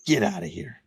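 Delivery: breathy stunned whisper, no voice; whispered disbelief. Real, not acted. Cohost hearing about the fake accent, whispered disbelief. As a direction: hush it out like you can't believe it.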